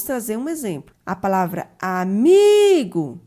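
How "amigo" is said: "amigo" slow, with a long, loud "mi"; stress on MI. Shown as a demonstration. Teacher giving an example word, "amigo", stress on "mi". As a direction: stretch "amigo" and punch the middle "mi". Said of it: "A sílaba mais forte dessa palavra é o mi".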